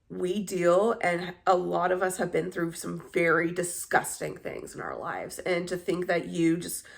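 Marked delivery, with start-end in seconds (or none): none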